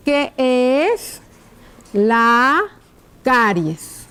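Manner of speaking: slow, word by word with pauses; writing-out dictation. Shown as a demonstration. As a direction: dictate it slowly as you write. Dictates while writing the question out. (dictado lento, palabra por palabra)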